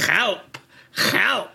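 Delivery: two spaced comic cries for help; imitated oddly accented plea; President Gomez. Shown as a demonstration. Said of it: "pulls his hair up and just goes".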